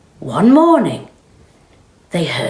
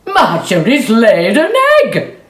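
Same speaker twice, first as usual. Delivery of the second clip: loud, higher amazed shout; excited cry; 7 semitones higher, 7 dB louder than usual. Acted. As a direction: announce the miracle. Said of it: "he cried".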